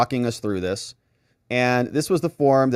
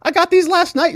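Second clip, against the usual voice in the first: mock indignant prosecutor; 5 dB louder than usual. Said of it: "acting prosecutor complaint".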